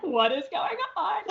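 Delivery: put-on high, laughing child's confusion; bewildered laughing. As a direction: laugh through a puzzled little-girl question. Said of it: "and I'm like".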